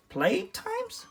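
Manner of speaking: very high, puzzled question; confused. A narrator's reading. Reptilus hears an unknown word, high and confused. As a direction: say the strange word like it makes no sense. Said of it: "Reptilus responded, confused".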